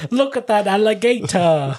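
high-pitched cartoon Australian; Australian accent. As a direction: exclaim like a stock Australian. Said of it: "they're from Australia".

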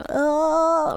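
open-mouthed ahh for the doctor; saying ahh. Retold or imitated. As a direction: say a long ahh with the tongue out. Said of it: "Ich so".

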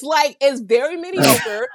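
usual speaking voice

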